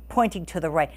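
usual speaking voice

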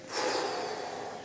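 audible breathy sigh as a demo. Shown as a demonstration. Showing the audience the diver's last breath, one sigh. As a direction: let out one big breath for them to hear. Demonstrating the breath before dive.

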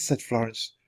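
plain reading voice

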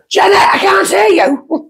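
high, drawn-out call across a church; retold calling out. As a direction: imitate a blunt teacher hollering to the back row. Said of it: "she would stop the service".

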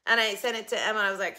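ordinary talk

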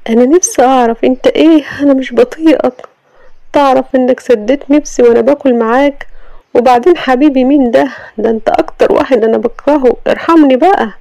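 wife rebuking the husband for banging on her door; indignant Sama. Acted. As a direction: snap back at the rude husband.

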